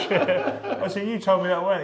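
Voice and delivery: high, loud laughing protest; laughing disbelief. Retold or imitated. Fighter laughing at his cut man's news.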